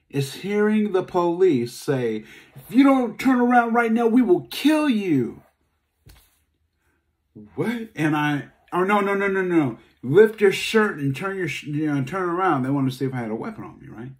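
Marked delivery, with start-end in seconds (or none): 2.5-5.4 high, loud barked police threat; loud hammed-up cop threat; police shout. 10.0-12.6 cop order. Retold or imitated. Police ordering him.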